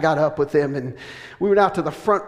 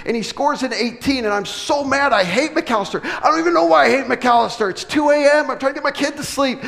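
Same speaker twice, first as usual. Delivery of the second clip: higher and louder, hammed-up rage; mock fury; 9 semitones higher, 5 dB louder than usual. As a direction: build from annoyed to ranting over a darts game. Angry over a darts result.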